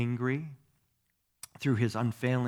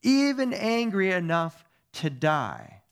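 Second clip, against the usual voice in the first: high, loud, slowed-down retort; angry retort; 7 semitones higher, 6 dB louder, slower than usual. A narrator's reading. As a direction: throw it back dramatically as Jonah. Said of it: "Jonah retorted".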